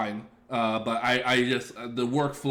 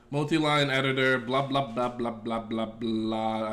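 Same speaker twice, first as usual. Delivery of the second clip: normal talking voice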